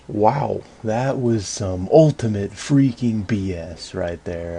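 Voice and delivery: loud, drawn-out disgusted disbelief; real exasperation. Real, not acted. Player venting to viewers after unfair boss attack, exasperated. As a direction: vent loudly, leaning on each word.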